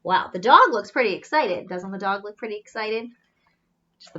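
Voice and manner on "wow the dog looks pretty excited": plain reading voice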